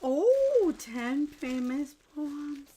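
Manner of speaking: high, loud "oh" easing into slow wonder; real excitement. Real, not acted. Discovering a poem book in the gift, thrilled wonder. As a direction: exclaim high, then read the title slowly. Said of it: "I'm so excited".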